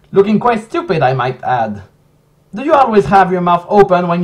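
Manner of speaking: hammed-up insulting rant, voice pushed up; dramatic reading. Reading the DVD box insults aloud, loud hammed-up rant. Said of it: "Let's do a dramatic reading".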